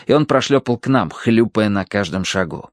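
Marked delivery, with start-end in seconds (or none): none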